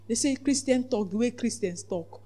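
normal talking voice

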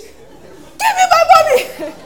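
very high, loud, slow cry; high-pitched demand. Pretend. Small-voiced passenger demanding money, high loud cry. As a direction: shriek it out for your money, laughing. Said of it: "that's when you hear high pitch".

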